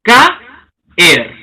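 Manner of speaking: "caer" split into ca, pause, er; syllable-by-syllable caer. Shown as a demonstration. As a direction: say "caer" as two separate beats, the vowels pulled apart.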